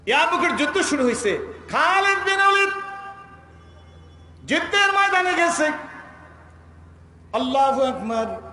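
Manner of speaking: high, ringing battle proclamation; impassioned preaching. A narrator's reading. Preacher telling the crowd Yarmuk has begun, impassioned preaching. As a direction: declaim each phrase high, with pauses between.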